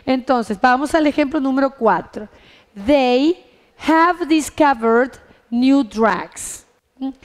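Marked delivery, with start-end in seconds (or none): 2.9-6.7 slow, word-by-word model sentence; measured, deliberate classroom dictation; slow example reading. Shown as a demonstration. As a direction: read it out slowly for English learners. Teacher models English example sentence.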